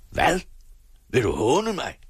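high-pitched, acted angry outburst; screaming. A narrator's reading. Father accusing his sawing son of mockery, screaming. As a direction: cry out as an affronted father. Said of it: "skreg faren".

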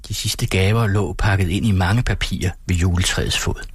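ordinary delivery, nothing marked

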